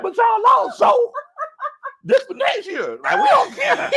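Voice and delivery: high, loud, hilarious defiance; laughing shouted exchange. Acted. Answering doubters about losing, high laughing shouts. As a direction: yell it high, breaking up laughing.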